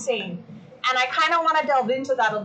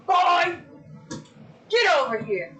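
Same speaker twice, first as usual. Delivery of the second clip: calling the dog; 6 semitones higher than usual. Real, not acted. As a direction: summon the dog across the room.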